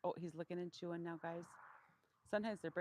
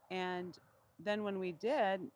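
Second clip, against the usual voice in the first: ordinary talk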